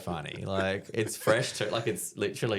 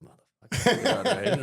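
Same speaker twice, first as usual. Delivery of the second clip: grudging curse; 14 semitones higher, 6 dB louder than usual. Retold or imitated. Big dog reacts to the joke.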